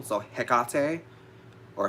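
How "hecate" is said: Hecate drawn out slowly as heh-KAH-tay; middle-syllable stress. Shown as a demonstration. Witch demonstrating the strong emphasis, middle-syllable stress. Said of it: "putting a strong emphasis on the middle syllable".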